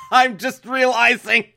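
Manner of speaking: high, loud cry of comic dismay; laughing mock horror. Real, not acted. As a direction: yell it, caught by your own words. Horrified at own word choice.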